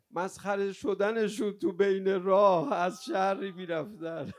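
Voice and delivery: loud, high, slow mourning cry; wailing lament. Real, not acted. Preacher mourning captives mocked on the road, wailing lament. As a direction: draw the words out in a grieving wail. Eulogist wails over captives' humiliation.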